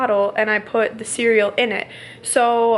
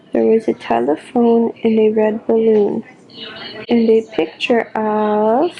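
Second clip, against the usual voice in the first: put-on reading to a baby; slower than usual. Mom reading a bedtime book to her baby. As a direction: read it slowly to the baby at bedtime. Reads Goodnight Moon to baby.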